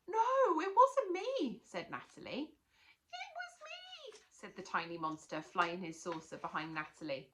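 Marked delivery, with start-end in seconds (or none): 0.1-1.6 high, loud, pleading denial; protesting. Acted. Natalie denying to teacher, high loud protest. 3.2-4.2 very high, soft squeak; tiny monster. Acted. Tiny monster owning up to Natalie, high squeak.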